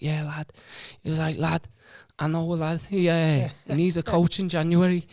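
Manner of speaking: retelling a mate's chat in a mock Irish voice; imitating an Irish lad. Ash quoting the Dubai contact in a light Irish accent. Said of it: "He's Irish, is he?".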